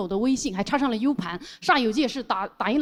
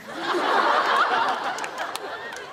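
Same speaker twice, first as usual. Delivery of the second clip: breathless high laugh. Real, not acted. 笑出声.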